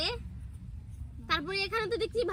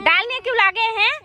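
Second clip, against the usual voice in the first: loud, higher mother's reprimand; scolding mother; 5 semitones higher, 10 dB louder than usual. Pretend. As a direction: tell the kids off for fighting. Mother scolds the quarrelling kids.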